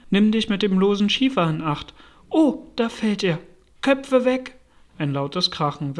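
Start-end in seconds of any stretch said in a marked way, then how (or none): 0.1-4.6 urgent, raised warning cry; higher, louder alarm call; shouted warning. Acted. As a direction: call out danger to the crew. Workers shout warning of falling slate.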